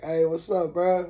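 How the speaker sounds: cheerful shout up into the air; calling up. Retold or imitated. Calling up to man on lift.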